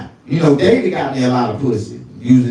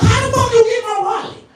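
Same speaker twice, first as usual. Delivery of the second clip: louder, higher self; high indignant retort; 3 dB louder than usual. Retold or imitated. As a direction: snap back indignantly. His own retort.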